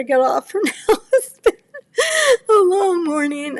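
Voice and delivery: real teary laugh breaking into crying; on the verge of tears. Real, not acted. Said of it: "I'm gonna cry".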